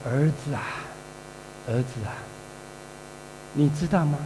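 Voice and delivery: father sadly calling his resentful son; sorrowful father. Retold or imitated. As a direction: speak gently through sorrow. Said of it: "他爸爸觉得很难过".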